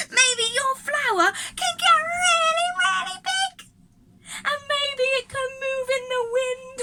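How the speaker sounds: very high, loud, thrilled praise; excited. Real, not acted. Teacher marvels at the children's big flowers, high and thrilled. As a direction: squeal with delight at how big they grow. Teacher cheering kids growing.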